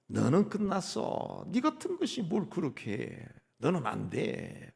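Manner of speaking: high, slow, soft contemptuous taunt; sneering Satan. Acted. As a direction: belittle him like a tempter. Satan condemns the believer. (높고 느리게 깔보는 사탄의 목소리)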